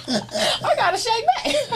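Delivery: high, slow, laughing through the words. Real, not acted. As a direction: say it cracking up. Repeats line through laughter.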